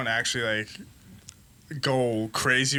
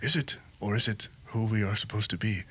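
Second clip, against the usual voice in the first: a hint of a low, serene monk voice, lower toward the end; lightly put-on monk, sinking lower and softer; monk accent; 7 semitones lower, 4 dB quieter than usual. Monk player answers his companion in a light mock monk voice. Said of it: "Are you doing an accent?".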